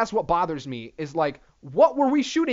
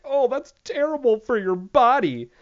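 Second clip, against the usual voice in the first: mock preachy scold; 6 semitones higher, 4 dB louder than usual. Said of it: "I'm like".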